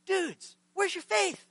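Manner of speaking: high-pitched, exasperated challenge; a little upset. Acted. Jesus turning to his amazed disciples, mildly upset and exasperated. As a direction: pitch it up in mild disbelief. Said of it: "Jesus is a little bit upset".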